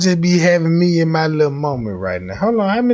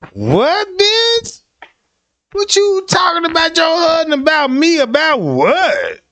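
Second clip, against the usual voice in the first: much higher, shocked squeal of outrage; mock-indignant high what; outraged disbelief; 9 semitones higher than usual. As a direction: act stunned and betrayed. Shocked fan has a husband.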